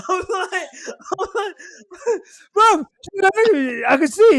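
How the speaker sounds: high squeaky voice breaking with laughter; laughing while quoting. Real, not acted. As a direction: squeal the words out through laughter.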